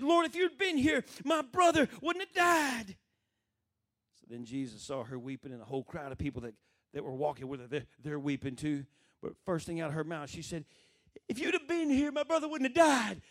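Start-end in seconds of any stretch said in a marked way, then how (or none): 0.0-3.0 high, loud, drawn-out cry of blame; acted anguished reproach. Mary falls at Jesus' feet, loud anguished reproach. 11.3-13.2 high, loud accusing cry; acted reproach. Weeping Mary to Jesus about her brother, loud reproach. Mary's first words, acted out.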